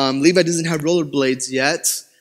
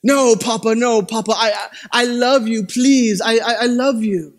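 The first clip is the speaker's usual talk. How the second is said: higher-pitched mock begging of a small boy; pleading son; 4 semitones higher than usual. Son begging papa not to give a pow pow, imitated pleading. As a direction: imitate a child pleading his way out of punishment. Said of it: "Son, I gotta give you a pow pow".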